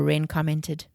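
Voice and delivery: plain reading voice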